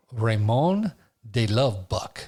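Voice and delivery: slow, mock French accent on the name; playful fake French accent introducing Raymond. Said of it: "I talk with the French accent".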